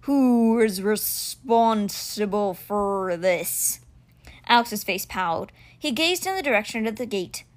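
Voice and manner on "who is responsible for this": very slow, forceful creature demand; growling stone cheetah. Acted. Stone cheetah demands to know who is responsible, growling. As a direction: stretch each word out fiercely.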